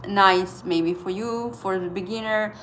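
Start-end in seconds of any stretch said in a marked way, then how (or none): none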